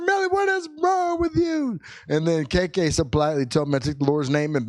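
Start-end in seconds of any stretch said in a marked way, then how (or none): 0.0-1.8 high, exasperated mock yell; retold yelling. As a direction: scold the child in a high, hammed-up cry. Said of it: "start yelling at her".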